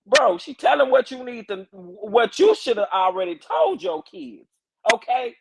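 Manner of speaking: high-pitched, exasperated telling-off; exasperated scolding. Real, not acted. Scolding the absent father.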